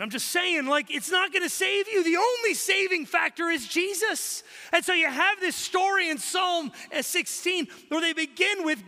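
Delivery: loud, high, driving sermon voice; impassioned pastor at full volume; full-force preaching. Acted. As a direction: preach it hard to the whole room. Fervent preaching on mortality and Jesus.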